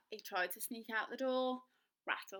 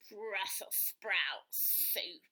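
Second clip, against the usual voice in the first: very slow, reluctant wolf; disgusted resignation; glum wolf; 2 semitones lower, much slower than usual. Acted. Wolf forced to eat sprouts.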